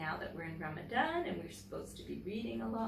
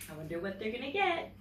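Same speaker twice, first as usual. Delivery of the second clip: usual speaking voice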